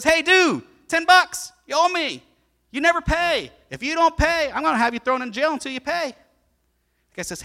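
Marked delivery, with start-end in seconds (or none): 0.0-6.2 loud, high aggressive demand; demanding creditor. Acted. Preacher voicing the forgiven man angrily demanding his ten bucks. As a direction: shake him down for ten bucks.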